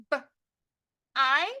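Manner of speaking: short B, pause, long I; sounded out. Shown as a demonstration. Teacher tapping the first syllable, sounded out. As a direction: break b-I into single sounds. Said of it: "tap out a word".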